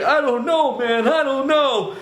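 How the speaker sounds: a frightened man at a loss; panicked. Retold or imitated. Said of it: "And he's like".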